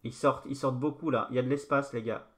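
ordinary talk, nothing marked

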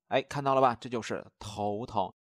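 usual speaking voice

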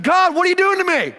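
high, loud frustrated plea; exasperated cry to God. Retold or imitated. Pastor retelling shouting at God backstage, high loud exasperated cry. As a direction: throw it up at heaven in despair. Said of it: "looked up at heaven and said".

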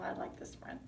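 soft unvoiced whisper; hushed whisper to the class. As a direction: lean in and whisper it like a secret. Puppet friend introduced softly.

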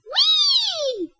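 very high, long gleeful squeal; gleeful whee. Acted. Papa Bear squeals with glee flying back to the porridge. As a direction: squeal with joy as you fly.

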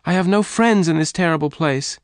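high-pitched despairing outburst; crying out. A narrator's reading. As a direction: lament it emotionally, pitched high. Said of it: "Jane cried".

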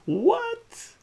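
very high, loud yelp; shocked outcry. A narrator's reading. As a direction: shriek it in comic disbelief. Hero shocked at moving to planet.

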